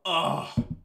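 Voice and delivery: a loud, high, disgusted groan. Real, not acted. Reacting to Yuri's confession, a loud disgusted groan. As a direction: groan at the screen.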